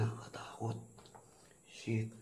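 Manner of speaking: very soft, voiceless murmur of fear; frightened whisper. Real, not acted. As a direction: whisper it, spooked. Scared, alone in haunted place. (takot na pabulong)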